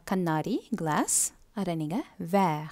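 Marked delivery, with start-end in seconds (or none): 2.3-2.6 French "verre", said vair; French pronunciation. Shown as a demonstration. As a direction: say "verre" as one syllable. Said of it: "ன்னு தான் சொல்லணும்".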